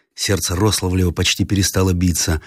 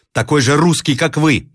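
defiant retort; 8 semitones higher than usual. A narrator's reading. Roslavlev answers officer defiantly.

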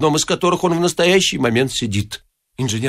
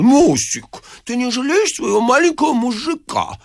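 put-on high babyish plea; wheedling husband; 12 semitones higher than usual. A narrator's reading. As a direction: beg like a pouting little boy. (капризно канючить тонким голосом)

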